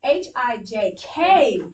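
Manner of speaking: H, I, J, K loud and emphatic, big finish on K; exclamation. Shown as a demonstration. Teacher leads class in exclamation mark, loud exclaimed delivery. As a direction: call out the letters with force. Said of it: "I have an exclamation mark".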